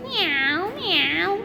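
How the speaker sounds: drawn-out cat meow, pitch swooping up. Shown as a demonstration. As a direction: imitate a cat's long, gliding meow. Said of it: "It comes like".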